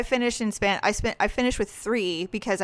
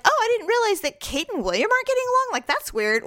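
surprised; 12 semitones higher, faster than usual. Retold or imitated. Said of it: "I was like".

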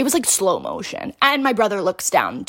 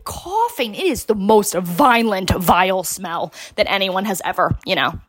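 ordinary talk

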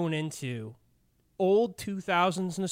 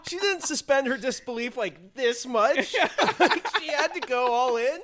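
1 amused shock; 8 semitones higher, 7 dB louder than usual. Real, not acted. Reacting to review, amused.

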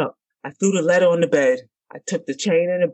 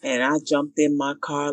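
ordinary talk

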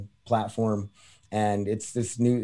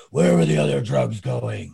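same line, voice gone after coughing; lost-voice Batman. Retold or imitated. Retelling a sketch, Batman repeats the threat after losing his voice. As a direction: croak it out as if the voice just gave out. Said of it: "I just lost my voice".

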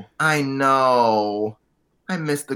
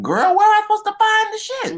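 high squeal; 6 dB louder, faster than usual. Retold or imitated. Quoting herself to friend.

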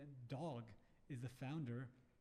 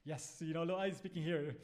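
louder, mock-official phone manner; mock professional; 2 semitones higher, 6 dB louder than usual. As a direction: play the composed professional announcing himself. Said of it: "I had to be professional".

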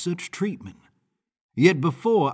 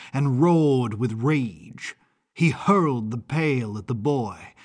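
ordinary delivery

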